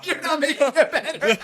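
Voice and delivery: helpless laughter, high and loud; laughing protest. Real, not acted. Co-host teasing over the diaper joke, cracking up. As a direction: squeal it out through real laughter.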